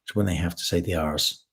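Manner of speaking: low-pitched imitation American accent; deep American accent, explaining. Shown as a demonstration. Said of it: "this is my American accent".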